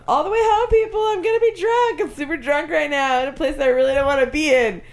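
put-on high, slurred blackout drunk girl. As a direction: play her sloppy drunk and giddy. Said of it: "this is what she sounds like".

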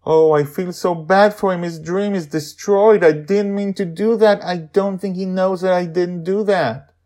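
put-on a girl full of guilt; upset girl Joy. Joy upset about her dad's destroyed dream. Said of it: "her mood turns dark".

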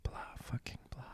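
voiceless whisper; stage whisper. Shown as a demonstration. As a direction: whisper it dismissively. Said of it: "Stage whisper".

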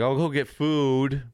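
high, drawn-out resolve; musing aloud, slow and high; acted-out idle decision. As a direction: pitch it up and stretch the words. Acting out a sudden idle plan.

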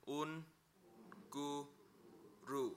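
un, gu, ru spoken one syllable at a time with pauses. Shown as a demonstration. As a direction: break un, gu, ru into slow separate syllables. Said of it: "say it as one word".

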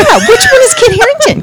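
high-pitched mock confusion; bewildered. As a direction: ask it in exaggerated, high bewilderment. Acting out own confusion while listening.

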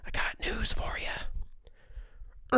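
slow unvoiced whisper; slow conspiratorial whisper; stage whisper. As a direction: lean in and whisper the warning. Teasing warning to listeners.

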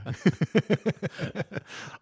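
big laugh. Real, not acted. Joking about getting rich.

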